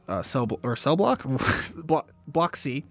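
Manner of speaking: ordinary delivery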